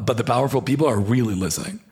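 normal talking voice

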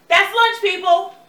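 high, loud announcement; calling out. Acted. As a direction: call the break to the crew. Director calling wrap.

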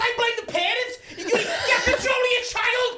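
very high, loud ranting scream; screaming at her. Acted. Man ranting at the kid's mother, screaming. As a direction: scream insults at full pitch, stretching them out. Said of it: "I'm screaming at her".

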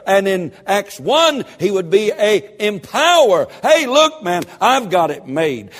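loud, high, rising sermon delivery; fiery revival preacher building to a cry; full-force preaching. Acted. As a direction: preach it hard and lift the room.

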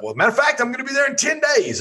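high-pitched excited quote; animated retelling of delighted surprise; retold eager reply. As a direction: re-enact an eager yes, pitch jumping way up.

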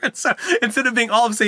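laughing through the words. Real, not acted. Host laughing while retelling the podcast story. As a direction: chuckle as you tell it.

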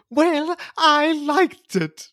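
high, drawn-out title call; put-on title intro. Trying to match the cohost's intro, high and drawn out. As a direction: stretch the words out, pitched high. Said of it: "I'm gonna try it too".

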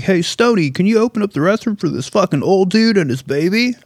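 calling clerk. Retold or imitated. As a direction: holler across the store like a clerk. Clerk calls to coworker Stoney.

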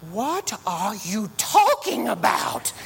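preacher acting out Edna scolding Elastigirl; Edna Mode. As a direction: lightly play the brisk fashion designer. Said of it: "and she says".

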